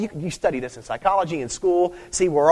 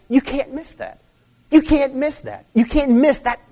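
preaching emphasis; 10 semitones higher, 4 dB louder than usual. Real, not acted. Preacher hammering the point home.

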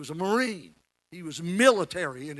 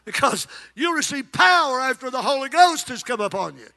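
raised, higher preaching voice, near shouting; full-force preaching; 7 semitones higher, 4 dB louder than usual. Acted. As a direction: preach it hard to the congregation.

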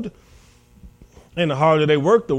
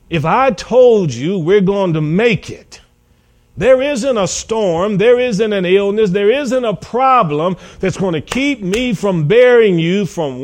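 raised, slow, forceful declaration; emphatic assurance; 4 semitones higher, 4 dB louder than usual. Acted. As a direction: declare it with a preacher's full conviction. Jesus voiced rebuking the disciples' doubt.

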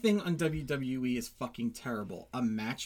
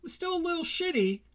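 higher, mocking food critic; 7 semitones higher, 5 dB louder than usual. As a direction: play a smug critic, lifted voice. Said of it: "they'd say".

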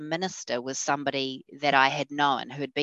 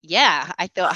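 usual speaking voice

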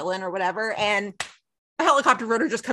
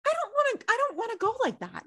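much higher, softer whine; dreading a freak death, squeaking high; whiny dread; 12 semitones higher, 4 dB quieter than usual. Real, not acted. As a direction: whimper it in mock horror.

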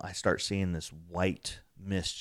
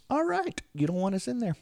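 retold giving in. Door slams, giving up to ghost.

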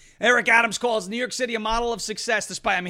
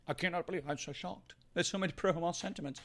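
a hint of a fake-shocked newsman, trailing soft; lightly mock the anchor's disbelief; 3 semitones lower, 3 dB quieter than usual. Mocking a TV anchor feigning shock, softer. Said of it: "the Jake Tappers of the world".

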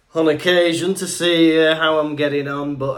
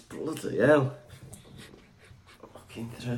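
ordinary talk, nothing marked

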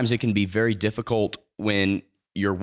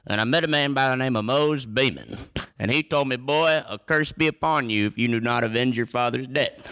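lightly voiced old fifties storyteller, a bit higher; a hint of an old-time Western grand marshal telling his tale; Frank Eaton impression; 4 semitones higher than usual. Retelling his father's death to the podcast hosts, lightly voiced old-timer. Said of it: "that's about what he sounded like".

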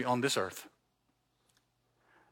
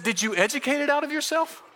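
church lady; 9 semitones higher, faster than usual. Retold or imitated. Church lady asks about accent.

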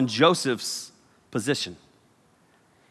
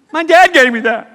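much higher, louder, laughing whine; mock whiny protest about the robe; whiny Joseph; 11 semitones higher, 9 dB louder than usual. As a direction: protest like a hurt kid brother.

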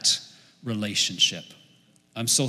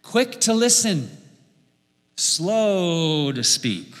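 "listen" lifted high, "slow to speak" dragged out very slowly; emphatic slow recitation; 3 semitones higher, 3 dB louder, much slower than usual. Shown as a demonstration. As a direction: hammer the verse home phrase by phrase. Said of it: "Listen twice as much as you talk".

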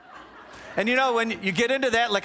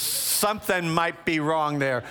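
usual speaking voice

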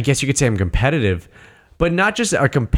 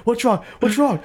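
a much higher, lightly mocking voice of a worried friend; roommate asking; 12 semitones higher than usual. Roommate Chet answering a frantic call, high mock-worried voice. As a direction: play the friend asking what's up.

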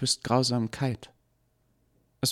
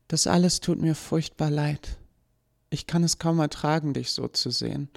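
much higher, gentle sorrowful voice; sad farewell; 8 semitones higher than usual. Acted. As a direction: say goodbye to her maker with sadness. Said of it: "lächelte ihm traurig zu".

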